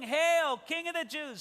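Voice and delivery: high-pitched sneering acclamation, falling at the end; jeering mock hail. Soldiers joking as they beat Jesus, high mocking hail. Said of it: "They're laughing and joking".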